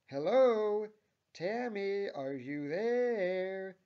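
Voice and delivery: very loud, high, drawn-out call; angrily frustrated. A narrator's reading. Manager trying to snap Tammy out of it, angrily frustrated. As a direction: holler for attention, stretching the last words. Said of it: "says the manager in angrily frustrated tone".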